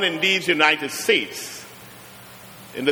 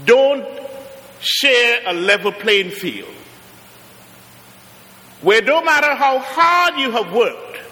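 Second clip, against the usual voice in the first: preaching; 6 semitones higher than usual. Acted. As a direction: press each phrase home to the congregation. Preaching on an unlevel playing field.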